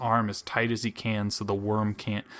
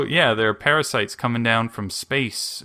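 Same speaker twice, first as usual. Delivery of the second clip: usual speaking voice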